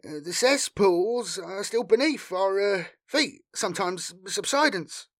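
high, hesitant voice full of uhs; nervous, trailing off. A narrator's reading. Frightened local explaining the collapse to a Marine, nervous and faltering. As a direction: explain falteringly, losing your nerve. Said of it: "he trailed off, his frayed nerves robbing him".

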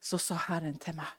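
usual speaking voice

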